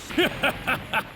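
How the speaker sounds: short, high, voiceless chuckle; smug villain chuckle. Acted. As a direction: snicker at a weaker foe. Villain mocks opponent.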